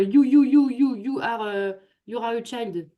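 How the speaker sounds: mock English in a French accent, starting loud and high; imitated scolding with a French accent. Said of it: "j'adore faire l'accent français".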